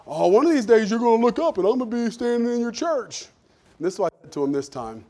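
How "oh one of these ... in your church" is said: high, loud, jovial voice; Marcus impression. Marcus teasing the preacher about coming to church, loud and jovial. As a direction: promise it with easy bravado. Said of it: "he's one of those guys".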